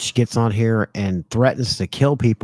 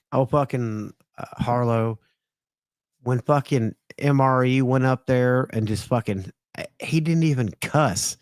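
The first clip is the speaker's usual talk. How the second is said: ordinary talk, nothing marked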